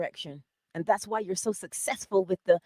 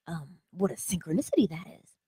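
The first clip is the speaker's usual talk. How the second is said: hushed mock awe. Mock awed whisper.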